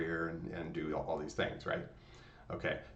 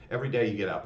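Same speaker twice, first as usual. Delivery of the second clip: usual speaking voice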